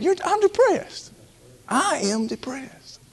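high, slow confessing voice; demonstrated admission. Shown as a demonstration. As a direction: show the congregation how to admit it. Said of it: "you gotta admit".

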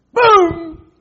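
sudden explosive high boom; startling imitation of a bang; shouted boom. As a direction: burst out loud like a blowout. Imitates the tire blowing out.

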